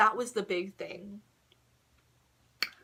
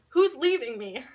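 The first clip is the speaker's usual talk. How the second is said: mild mock yell, higher and louder; retold scream; 5 semitones higher, 8 dB louder than usual. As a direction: call it out loudly, re-enacting a scream. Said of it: "I screamed".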